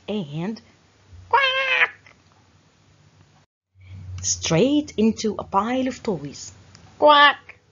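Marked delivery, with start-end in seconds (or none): 1.3-2.1 very high squawking quack, loud; a sudden high cartoon squawk; startled quack. A narrator's reading. Bugs lands in toys. 7.0-7.6 loud, blunt quack; duck quack. A narrator's reading.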